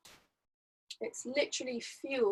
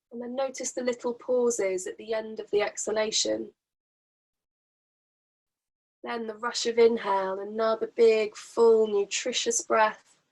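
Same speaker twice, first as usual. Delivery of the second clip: calm breathing-guide voice; guided breathing. Acted. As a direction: draw each breath out for them. Leads a breathing exercise.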